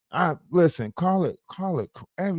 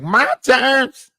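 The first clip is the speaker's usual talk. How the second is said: mock Maggie voice; 9 semitones higher, 5 dB louder than usual. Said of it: "She was like".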